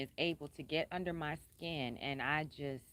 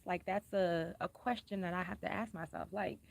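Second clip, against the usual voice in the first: usual speaking voice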